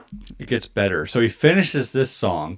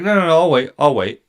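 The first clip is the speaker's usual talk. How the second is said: high, louder brush-off; mock insistence; 6 semitones higher, 5 dB louder, faster than usual. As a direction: imitate a pushy singer insisting. Singer's insistent refusal, acted.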